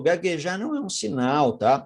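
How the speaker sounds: ordinary talk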